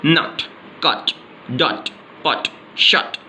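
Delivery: each word from "नट" to "शट" said with a short vowel; quick short O. Shown as a demonstration. Teacher drills short-vowel examples, quick short O. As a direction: say "नट, कट, डॉट, पट, शट" as separate quick words. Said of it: "जल्दी से बोल रहे हैं".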